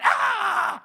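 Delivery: a sudden high, loud little "Ah!" of triumph; child's excited cry. Retold or imitated. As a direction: bark out a child's gleeful "Ah!". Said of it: "he looked at me and went".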